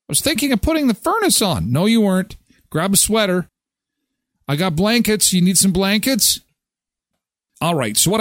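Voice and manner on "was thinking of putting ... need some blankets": higher mock spouse voices trading lines; imitating spouses. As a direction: act out the couple bickering. Acted exchange between spouses.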